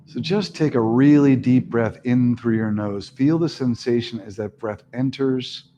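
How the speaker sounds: steady, calm breathing cues; guided meditation. Acted. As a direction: lead the breath gently.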